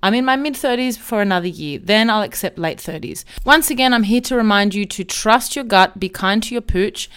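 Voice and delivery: normal talking voice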